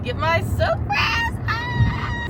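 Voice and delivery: high drawn-out thrilled shriek; excited squeal. Real, not acted. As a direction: squeal with anticipation. Excited about upcoming gift.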